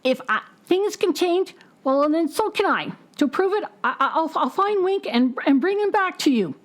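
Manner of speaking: high crow voice, stumbling over words; high, halting crow voice promising to fix it; stammering crow. Acted. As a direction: stammer it like a crow turning brave. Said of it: "looks up and says".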